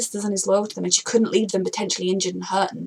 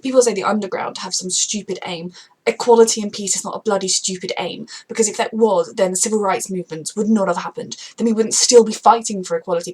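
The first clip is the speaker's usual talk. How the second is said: louder, heated rant; getting angry; 3 semitones lower, 5 dB louder than usual. Real, not acted. Fan ranting angrily about the villains' cause. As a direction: build into indignant anger. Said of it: "And now I'm getting angry".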